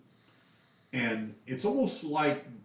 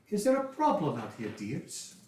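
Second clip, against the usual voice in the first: lady stepping in; 7 semitones higher than usual. Acted. Lady Drana confronts robed men.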